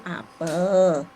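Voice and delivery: APEL drawn out in two long syllables; modelling a word. Shown as a demonstration. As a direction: stretch APEL slowly for a child to copy. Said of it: "begitu diam kita ngomong".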